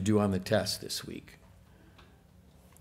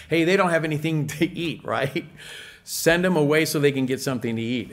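mock disciples complaining; 4 semitones higher than usual. Voicing tired hungry disciples.

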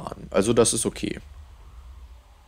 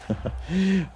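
laughing; 9 semitones higher, 5 dB quieter than usual. Real, not acted. Laughs at own pun.

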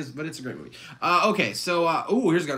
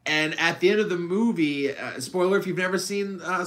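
usual speaking voice

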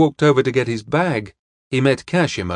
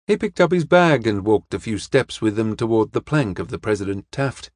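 ordinary delivery

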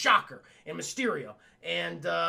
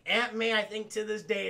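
ordinary delivery

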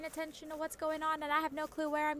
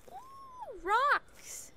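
excited squeal; 3 dB louder than usual. Real, not acted. Excited at finding rocks.

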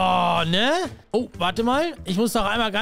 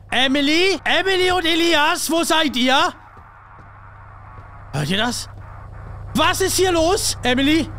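much higher and louder alarmed calling; panicked shouting; 7 semitones higher, 4 dB louder than usual. Acted. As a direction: shout in rising panic through the flat. Panicked search for kids.

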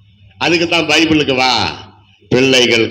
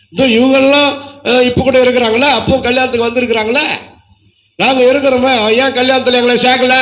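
put-on curious little girl; 8 semitones higher than usual. Preacher voicing his little daughter at the wedding album, high and pleading. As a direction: play the small daughter, puzzled and pleading.

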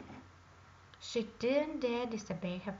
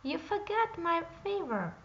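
indignant frog; 7 semitones higher than usual. A narrator's reading. Frog calls after princess.

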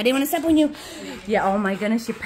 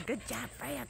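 soft praise; 20 dB quieter than usual. Real, not acted. Quiet praise to the dog.

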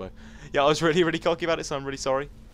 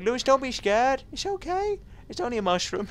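much higher put-on voice; mock reassurance, pitched way up; viewer impression; 14 semitones higher than usual. Viewer telling the player not to be scared, mock soothing. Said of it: "So you're like".

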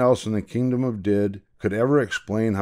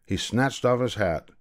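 plain reading voice

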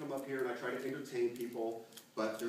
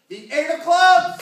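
triumphant shout; 21 dB louder, slower than usual. Real, not acted. Big card reveal to audience.

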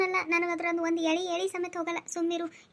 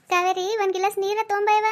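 higher, louder call across the house; 4 semitones higher, 6 dB louder than usual. Acted. Mother-in-law calls Kaveri for water, calling out loud. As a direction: call for the maid from another room.

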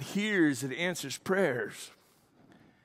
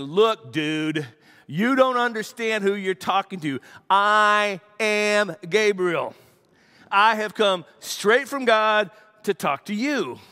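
louder, higher, slow and emphatic; ticked-off angel; 4 semitones higher, 6 dB louder, slower than usual. Acted. Gabriel rebuking doubting Zechariah, louder ticked-off voice. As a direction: put the doubter firmly in his place. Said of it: "a little bit ticked off".

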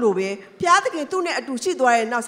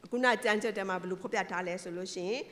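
ordinary delivery

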